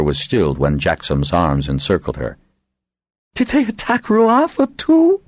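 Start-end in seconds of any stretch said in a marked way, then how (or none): 3.3-5.2 much higher, slow horrified cry. A narrator's reading. Shara asking Jackson about Ruatha, much higher horrified cry. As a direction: gasp out the fear for her medicines. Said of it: "she cried, horrified".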